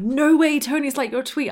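high-pitched, imitated amazement; amazed reaction. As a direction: act out a friend's disbelieving excitement. Friends react amazed to the tweet.

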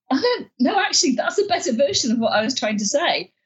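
high, bright realization, voice lifted; surprised realization. Retold or imitated. New member realizing the peer's version is better, said high and surprised. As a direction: catch yourself, delighted.

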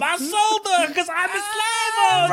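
high squeaky alien slaver; high Watto voice. Retold or imitated. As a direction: shout it like a callous alien. S1 voicing Watto, high and far.

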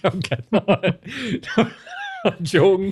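laughing hard, slow and high. Real, not acted. Taking back his joke about her DMs, laughing. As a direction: giggle out the take-back.

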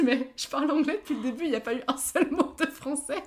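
indignant protest. Retold or imitated. Retelling her reply to a confused friend, loud mock indignation. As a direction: protest loudly that it was all English.

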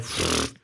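soft blown raspberry-like puff; dismissive pfft. Retold or imitated. Studio waving away Man of Steel, dismissive pfft. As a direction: puff it out, brushing off the problem. Imitating studio shrugging off problem.

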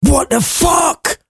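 very high, slow, shocked shout; loudly exclaiming. Acted. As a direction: stretch out a stunned, shrill-high cry. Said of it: "loudly exclaiming".

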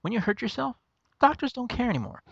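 a lightly voiced comedian bit; imitating Louis C.K. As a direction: retell the bit in a lifted voice. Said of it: "He's over there going".

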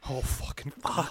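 low, barely voiced dread; hushed panic. Parent secretly dreads the closet check, hushed panic. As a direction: swear under your breath, privately scared. Secret fear behind brave act.

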